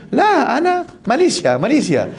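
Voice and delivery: hammed-up clueless pilgrim insisting; confused pilgrim.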